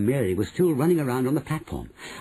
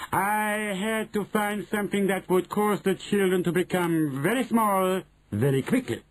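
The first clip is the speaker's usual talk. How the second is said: high put-on witch voice; Grand High Witch, self-important; 7 semitones higher than usual. Grand High Witch explaining her formula, self-important. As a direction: lecture the coven from on high.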